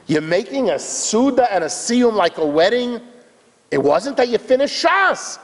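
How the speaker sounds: high, swooping disbelief; acted incredulous onlooker; incredulous questioning. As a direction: challenge him in puzzled astonishment. Crowd questions the man, acted.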